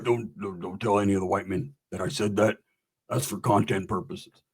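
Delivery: low, hushed mock disclaimer; confidential aside. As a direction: drop your voice as if sharing a secret. Confidential joking disclaimer to audience.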